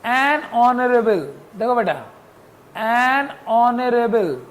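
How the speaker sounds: एन ऑनरेबल slowly, h silent; slow, spelled-out एन ऑनरेबल starting on the vowel; careful slow demo. Shown as a demonstration. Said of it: "an honorable में H भी silent है". (धीमे-धीमे एन ऑनरेबल, H silent)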